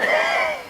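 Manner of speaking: very high, quick giggle. Acted. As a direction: let out a high-pitched little giggle. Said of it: "I had to giggle".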